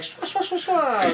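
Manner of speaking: very high, comic "swa" swishes; imitated swishing work noise. Man mimicking old ladies killing it at cleaning, comic swishes. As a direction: mimic speedy scrubbing with a squeaky noise.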